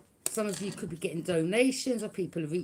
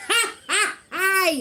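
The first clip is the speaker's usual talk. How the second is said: mocking laugh; 10 semitones higher, 7 dB louder, slower than usual. Real, not acted. Laughing at the haters.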